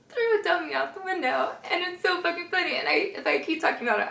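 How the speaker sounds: high, tearful laughing voice; laughing to tears. Real, not acted. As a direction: struggle to talk through real giggles. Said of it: "I'm gonna cry again".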